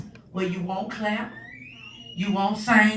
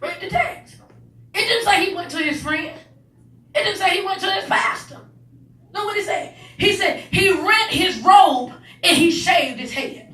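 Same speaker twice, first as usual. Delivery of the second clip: raised, loud, driving sermon cadence; higher and louder gospel preaching; preaching at full force; 5 semitones higher, 4 dB louder than usual. Acted. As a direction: preach it, building the fire.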